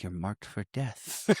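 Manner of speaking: low, slow, hushed menace; creepy threat. Retold or imitated. Barry telling one of the mice, creepy threat. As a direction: imitate a creep quietly dooming his victim. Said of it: "he's getting all creepy with the mice".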